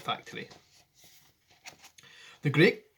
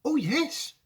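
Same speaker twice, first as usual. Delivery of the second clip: put-on raised, eager boy's cry; excited cry; 12 semitones higher than usual. Charlie answering Wonka, lightly eager cry. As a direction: blurt it out, delighted. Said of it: "cried Charlie".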